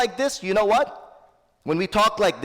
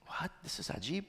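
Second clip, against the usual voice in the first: retold amazement, much softer; hushed astonishment; 18 dB quieter than usual. Master reacting to his servant's answer, in hushed amazement. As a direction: drop the volume right down for an awed reaction.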